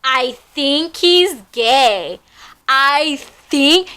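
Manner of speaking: slow, mocking echo of the words; sarcastic slow parroting; mocking repeat. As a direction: repeat his line with scorn. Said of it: "you think?".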